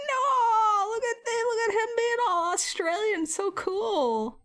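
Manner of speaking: high-pitched mock fawning; squeal-gush like a smitten fan, hammed up; gushing awe. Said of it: "we were like".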